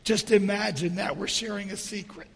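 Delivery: hushed, hoarse, nearly lost voice; stage whisper. Real, not acted. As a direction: speak softly through a failing voice. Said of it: "sounds like I'm doing the whole sermon in a stage whisper".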